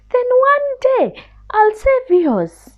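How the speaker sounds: a tiny scared mouse begging pardon; little mouse voice. Acted. Mouse bargains.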